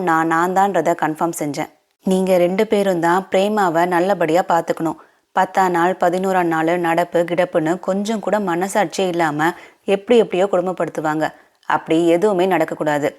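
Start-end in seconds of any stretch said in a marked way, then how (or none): none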